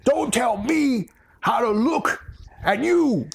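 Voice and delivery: high-pitched, indignant tirade; ranting boss. Acted. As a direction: stretch the words out in high, mock outrage. Acted boss's tirade.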